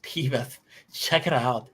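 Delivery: hint of a dumb cartoon buddy; Beavis and Butthead. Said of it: "a great Leno and a great Beavis".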